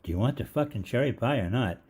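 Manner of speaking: yelled high and loud across the lot; yelling out. Retold or imitated. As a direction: shout it out the car window, mocking. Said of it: "one guy yells out".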